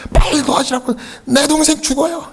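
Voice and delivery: put-on a boy watching his brother drown; panicked child cry. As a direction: call for help in panic.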